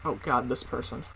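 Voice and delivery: low, soft aside; hushed, low retold groan of dread; muttered dread. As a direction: mutter it under your breath. Students dreading the substitute, muttered.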